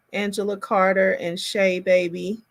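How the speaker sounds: ordinary delivery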